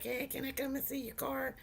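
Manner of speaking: soft, lightly imitated kid on the phone; little kid caller. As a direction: quietly mimic a young caller, a hint of kid. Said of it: "sound like a little kid".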